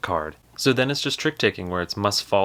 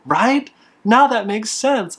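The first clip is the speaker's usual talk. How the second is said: high, loud, slow self-satisfied; smug customer; 13 semitones higher, 7 dB louder, slower than usual. Retold or imitated. The customer agreeing with himself to the son, high and smug. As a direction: lean into his smugness.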